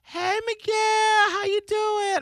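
high, slow, sing-song greeting from the mom; mock mom voice; imitating mom. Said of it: "immediately she was like".

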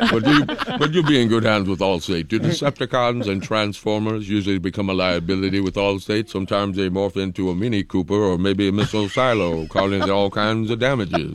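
deep smooth commercial announcer; insurance ad announcer. Pretend. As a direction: read it like a TV insurance ad. Allstate ad parody.

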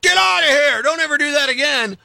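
scare the nurse off with a big yell; mock scream. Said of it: "just scream at him like".

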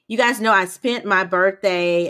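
ordinary talk, nothing marked

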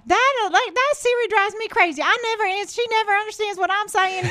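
high-pitched exaggerated Southern impression; super southern accent. Host imitating friends from southern states, super southern accent. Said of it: "some of my friends talk. I mean, they're super southern".

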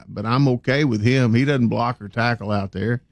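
ordinary delivery

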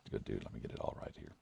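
whispering to himself; breathy whisper off mic; whispered aside. Real, not acted. Aside while reading name.

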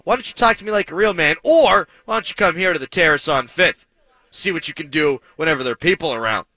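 hammed-up radio bravado dare; fake tough guy. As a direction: puff up and call him out like a pretend tough guy. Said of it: "That's me being fake tough again".

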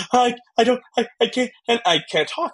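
retelling a breakdown, voice high and halting; choked up. Retold or imitated. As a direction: stammer out that you can't talk. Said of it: "And I'm just".

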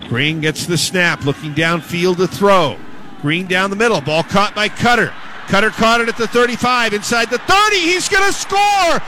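rising excited radio call building to a shout; play-by-play call. Acted.

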